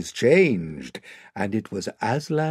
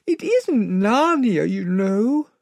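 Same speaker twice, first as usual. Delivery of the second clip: put-on high, slow, distressed child voice; tearful child; 8 semitones higher, slower than usual. Lucy grieving to the lion, tearful. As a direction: protest as if near sobbing, pitched high. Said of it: "sobbed Lucy".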